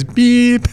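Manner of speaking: high, short spoken beep, an Irish-free mock bleep; imitated censor beep. Host mimicking the producer bleeping out swearing, high mock beep. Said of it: "Wizard goes".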